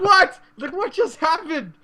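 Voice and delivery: high, sudden what; startled disbelief. Retold or imitated. Player retelling getting one-shot by Zeus, high disbelief. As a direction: act out the shock of dying instantly. Retold confusion after dying.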